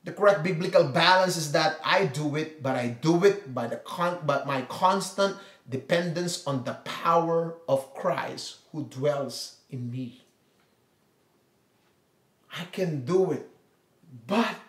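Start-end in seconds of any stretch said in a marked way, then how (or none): none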